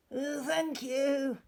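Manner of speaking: high, thankful giraffe voice; grateful giraffe. Acted. Giraffe thanking George for the scarf, high and grateful.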